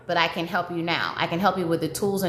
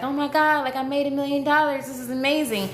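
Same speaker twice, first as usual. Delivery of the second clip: higher, squealing mock thrill over the money; imitating super excited; 7 semitones higher than usual. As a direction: replay the giddy rush she expected to feel. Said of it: "I thought that I was going to be, like, super excited".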